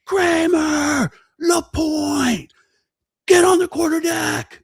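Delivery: high barked shout of names; drill instructor shout. Retold or imitated. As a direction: yell the order like a drill instructor. Drill instructor calling recruits.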